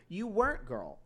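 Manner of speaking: ordinary talk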